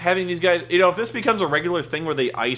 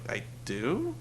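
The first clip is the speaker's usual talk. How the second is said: much softer, small "I do?"; meek question; 3 semitones lower, 11 dB quieter than usual. Retold or imitated. Ric Flair learning he must drink, soft sheepish question. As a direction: ask it sheepishly, caught off guard. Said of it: "And Rick's like".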